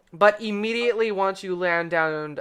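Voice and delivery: normal talking voice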